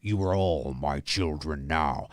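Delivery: low, slow growl of a voice; deep Freddy voice. Shown as a demonstration. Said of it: "but look at my mouth".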